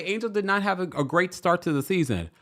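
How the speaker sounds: ordinary delivery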